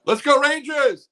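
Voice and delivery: high, loud stadium chant; cheering yell. Retold or imitated. Fan on the Jumbotron yells a loud, high stadium cheer. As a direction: belt it out like a fan on the big screen.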